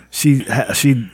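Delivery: ordinary talk, nothing marked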